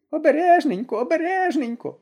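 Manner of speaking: high, alarmed granny calling out; a frightened old woman crying out, high; anxious old woman's cry. A narrator's reading. Granny on the roof cries out to the soldiers, high and alarmed. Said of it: "зойкнула на стрісі баба Мокрина".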